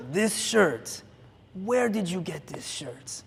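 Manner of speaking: a hint of a kung fu movie bad guy, slow; lightly voiced creepy fan, drawn out; kung fu villain. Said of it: "He sounds like a bad guy in a kung fu movie".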